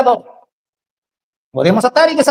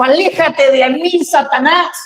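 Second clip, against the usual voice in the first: stern rebuke; 3 semitones higher, slower than usual. Retold or imitated. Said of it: "Jesús mismo le dijo".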